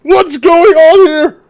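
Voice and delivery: loud high slow "what's going on here"; distraught cry. Acted. Loud high distraught cry. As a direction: Harvick horrified over the body. Harvick in shock.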